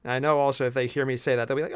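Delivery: ordinary talk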